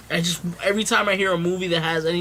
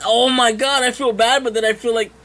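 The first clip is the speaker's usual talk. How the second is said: ordinary delivery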